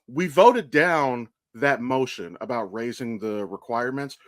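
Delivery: usual speaking voice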